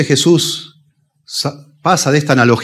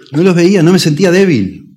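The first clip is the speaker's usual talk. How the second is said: higher and louder, hammering "No los veía"; emphatic louder repeat; 7 semitones higher, 5 dB louder than usual. Real, not acted. Preacher confesses past blindness, emphatic loud. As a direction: raise the voice to drive it home. Preacher recalls his pride.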